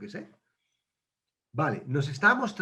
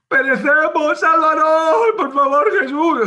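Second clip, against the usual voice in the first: much higher and louder, desperate; panicked plea; 10 semitones higher, 11 dB louder than usual. Acted. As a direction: scream for rescue like you are drowning. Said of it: "ellos ya creían que iban a morir".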